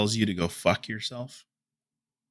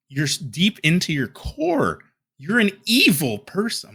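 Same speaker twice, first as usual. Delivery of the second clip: worked-up, higher-pitched scolding; indignant tirade getting out of hand; heated rant; 5 semitones higher than usual. Real, not acted. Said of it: "got a little out of hand there".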